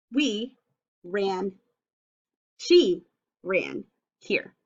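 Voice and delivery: slow, words spaced apart. Shown as a demonstration. Teacher rereading sentence with class. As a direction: point and read each word. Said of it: "Read it with me".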